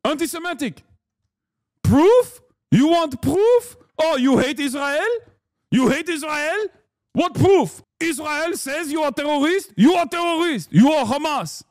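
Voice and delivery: put-on shrieking high, outraged Israeli accent; mock Israeli accent. Israel defender outraged at being asked for proof, in a high mock Israeli accent. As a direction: pile up incredulous questions in a squealing Israeli accent. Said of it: "please stop with this accent".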